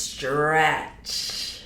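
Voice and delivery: "stretch" pulled out very long; very slow, elongated "stretch"; drawn-out word. Acted. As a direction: draw out "stretch" like the thing it describes. Stretches word to match image.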